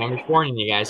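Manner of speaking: ordinary talk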